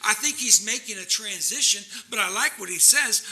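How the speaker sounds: normal talking voice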